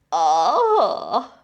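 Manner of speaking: loud surprised "ah"; startled ant cry. Acted. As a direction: yelp as if bumped. Ant bumped into, cries out.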